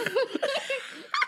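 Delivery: squealing laughter; high wheezing laugh. Real, not acted. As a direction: laugh until near tears. Laughing hard.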